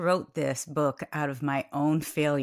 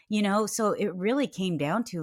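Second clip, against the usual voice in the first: ordinary talk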